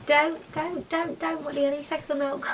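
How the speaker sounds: soft high dog talk. Real, not acted. Talking to her dog.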